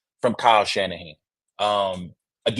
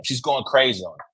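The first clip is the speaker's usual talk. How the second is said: usual speaking voice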